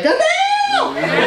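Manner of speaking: put-on high, squealing kid voice shouting; kid's shocked yell. Kid spotting the comedian, high startled yell.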